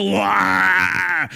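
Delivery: loud bluffing "wah" roar; mock devil roar. Preacher imitating the devil's empty noise, mock roar. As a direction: roar like a toothless devil putting on a show. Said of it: "the devil is very loud".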